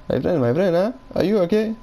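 lightly put-on Indian accent, rising high on the question; a playful Indian accent, lifting on are you okay. Teasing a game buddy in a mild Indian accent. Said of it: "I'm using the Indian accent".

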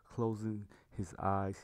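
plain reading voice

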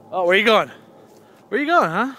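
high, loud call to the cat; talking to pet. Real, not acted. Calling to his wandering cat.

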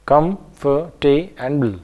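"comfortable" slowly as com, for, ta, ble; split into parts. Shown as a demonstration. Teacher breaking a word up for learners, "comfortable" syllable by syllable. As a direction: chop "comfortable" into separate syllables with short gaps. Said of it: "pronounce a word, uh, in parts".

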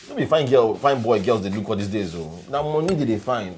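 frustrated rant. Acted. Henry ranting to Tony about money and girls, loud. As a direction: vent it at your friend.

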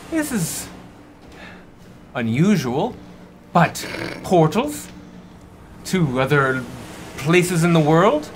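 high, halting captain reasoning aloud; puzzled captain. Acted. Captain explaining portals to the crew, high and searching. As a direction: stretch the words high, then stammer to a conclusion.

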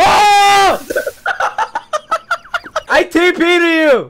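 loud, high yelp that breaks into laughter; shout into laughter. Real, not acted. Player laughs as Dylan lags back into lava, shouting then laughing. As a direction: burst out laughing at a friend's mishap. Friend gets lagged into lava.

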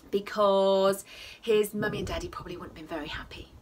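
hushed conspiratorial whisper; whispered aside. Real, not acted. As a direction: confide it under your breath. Soft aside to children.

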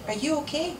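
high, gentle question; concerned wife. A narrator's reading. Wife checking on silent wet husband, concerned. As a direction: ask with anxious care.

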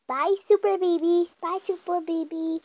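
ordinary delivery

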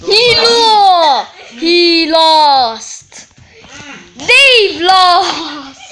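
laughing gloat. Real, not acted. Mocking the loser.

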